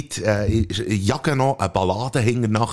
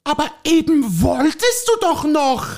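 a higher, fussy housewife voice pestering her husband; nagging wife; 3 dB louder, slower than usual. Acted. Wife nagging her husband to go for a walk, fussy. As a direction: play the nagging wife in a comic sketch. Acted dialogue sketch, wife role.

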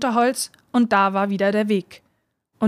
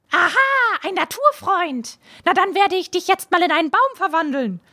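a gleeful witch toying with her victim; 8 semitones higher than usual. A narrator's reading. Witch mocking the father before turning him into a tree.

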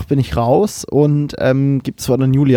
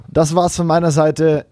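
usual speaking voice

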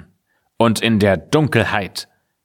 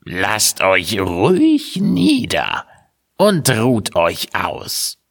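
slow, sugary witch voice; falsely friendly witch; slower than usual. A narrator's reading. Witch inviting the travellers, feigning friendliness. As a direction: sweeten it like a trap. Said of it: "und tat ganz freundlich".

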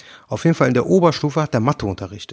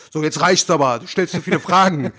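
mock-aggressive teacher, pitched up; 4 semitones higher than usual. Cornered teacher snapping at questioning pupil, mock aggressive. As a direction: cut off the questions in mock anger. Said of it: "einfach aggressiv geworden ist".

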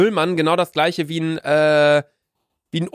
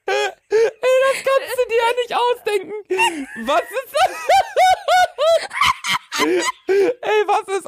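stifled giggles. Real, not acted. Laughing fit over job title.

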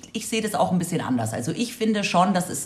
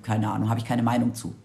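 indifferent mumble; 8 semitones lower, 4 dB quieter than usual. Retold or imitated. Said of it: "dann schaut der andere an und sagt".

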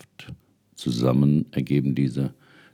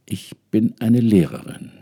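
pleading woman answering; 8 semitones higher, 6 dB louder than usual. Acted. Woman answers ancestors' voices.

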